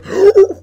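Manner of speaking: sharp, very high, loud gasp; imitated gasp. Imitating the dude's sound.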